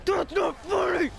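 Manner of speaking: high, slow, loud mock telling-off; scolding yell. Teachers tearing into whoever did it, hammed-up scolding shout.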